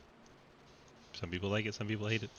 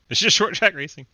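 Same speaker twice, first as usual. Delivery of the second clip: high, loud exasperated shout; 11 semitones higher, 14 dB louder than usual. Real, not acted. Mock exasperated outburst.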